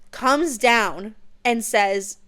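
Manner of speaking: drawn out slowly for suspense; dramatic slow. Shown as a demonstration. Building suspense for viewers as the dragon lands, slow. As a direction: stretch each word to build tension. Dragon arrives, retold twice.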